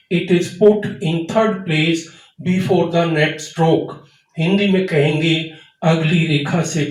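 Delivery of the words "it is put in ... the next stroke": very slow, word by word, for learners to memorize; slow dictation. Shown as a demonstration. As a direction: dictate the rule slowly. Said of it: "इस sentence को याद रखिए".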